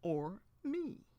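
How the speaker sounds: coy flirtatious tease; cunning question. A narrator's reading. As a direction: tempt him with the choice. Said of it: "asked cunningly".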